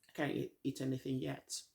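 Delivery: ordinary talk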